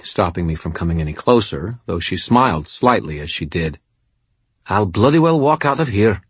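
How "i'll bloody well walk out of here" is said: atrocious fake Scottish accent; hammed-up Scottish brogue; bad Scottish accent. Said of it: "she said it in an atrocious Scottish accent".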